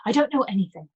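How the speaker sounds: level, flat and closed; a flat, shut-down denial. Acted. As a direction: say it flat, shutting the topic. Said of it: "Her voice was flat".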